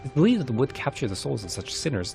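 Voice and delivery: plain reading voice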